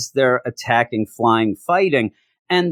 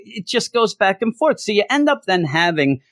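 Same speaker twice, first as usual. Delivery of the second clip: ordinary talk, nothing marked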